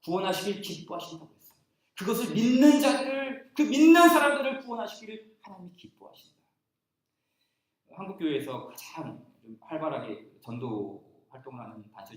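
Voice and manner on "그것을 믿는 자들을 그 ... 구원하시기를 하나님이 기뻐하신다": 믿는 hit high and loud; higher, louder insistence on 믿는; emphatic repeat. Shown as a demonstration. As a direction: punch 믿는 as you proclaim it. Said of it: "repeat with emphasis".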